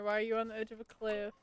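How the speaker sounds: usual speaking voice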